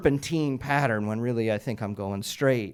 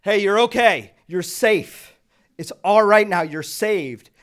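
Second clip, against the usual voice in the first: loud, raised call of reassurance; big commanding rescue shout; football voice; 9 semitones higher, 9 dB louder, slower than usual. Retold or imitated. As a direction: project it like a coach across a field. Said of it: "I use my football voice".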